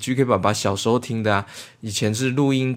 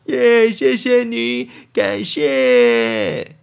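cheerful thanks; 11 semitones higher, 4 dB louder, much slower than usual. Real, not acted.

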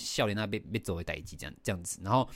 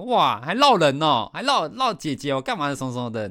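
sarcastic taunt; 5 semitones higher, 7 dB louder than usual. Retold or imitated. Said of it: "还有点酸言酸语". (拖长音、大声地酸言酸语)

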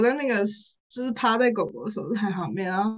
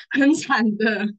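laughing; 4 semitones higher, 4 dB louder, much slower than usual. Real, not acted. Laughing while calling them miserable.

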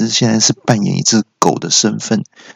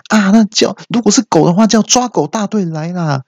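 high, pitched-up urgent shout-out; alarmed bystander; 10 semitones higher than usual. Acted. Bystander demanding the dog catchers, alarmed. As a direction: call excitedly for help in a high voice. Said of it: "旁边又有人说".

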